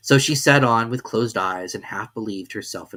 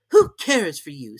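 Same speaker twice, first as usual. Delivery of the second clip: defiant Alice; 6 semitones higher, 7 dB louder than usual. A narrator's reading. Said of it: "said Alice".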